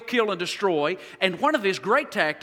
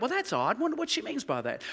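puzzled inner thought; 4 semitones higher, 7 dB quieter than usual. Retold or imitated. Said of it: "I thought".

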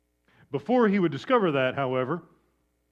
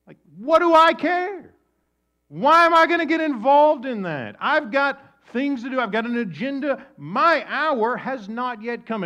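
higher, louder, brushing-off voice; dismissive Jesus; 9 semitones higher, 9 dB louder than usual. Acted. Jesus brushing off his mother, higher louder dismissive. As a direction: toss it off like you have bigger plans.

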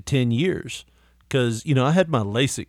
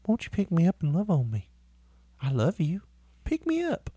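soft, higher begging dog voice; pleading puppy voice, softer; voicing the dog; 5 semitones higher, 5 dB quieter than usual. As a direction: coo like a needy little dog.

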